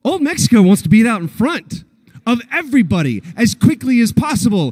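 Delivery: ordinary delivery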